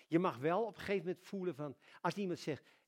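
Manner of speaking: ordinary delivery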